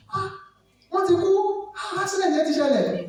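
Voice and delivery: high shocked cry into a phone; dramatized shock in Yoruba; shocked phone news. Retold or imitated. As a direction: gasp at bad news on a call. Acting shocked phone call.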